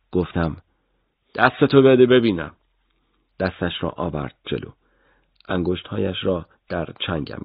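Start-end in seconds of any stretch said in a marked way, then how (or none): 1.3-2.5 stern order. Acted. Interrogator orders the boy.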